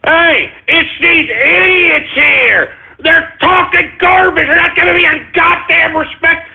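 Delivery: angry complaint. Acted. Host complains to engineer.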